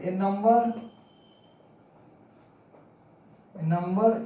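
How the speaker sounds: slow, word by word, for the class to write down; slow dictation. Shown as a demonstration. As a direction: dictate each phrase slowly and repeat it. Teacher dictates definition slowly. (बच्चों को लिखवाते हुए धीरे-धीरे बोलना)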